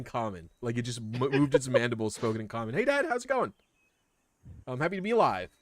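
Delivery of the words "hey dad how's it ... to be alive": high, bright little creature voice; squeaky baby spider. Acted. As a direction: chirp it like a newborn spider.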